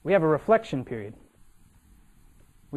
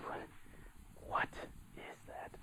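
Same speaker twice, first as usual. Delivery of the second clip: halting, barely voiced mock fright; frightened whisper; 22 dB quieter than usual. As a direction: whisper it haltingly from behind a friend. Hiding, retells frightened question.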